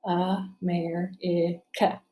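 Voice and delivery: "America" very slowly broken into four beats; teacher counting out each syllable of "America". Shown as a demonstration. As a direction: say "America" as a, mer, i, ca, pausing after each. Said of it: "Let's count the syllables in America".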